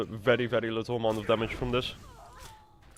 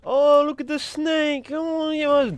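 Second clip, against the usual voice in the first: squeaky voice; 6 dB louder, slower than usual. Pretend.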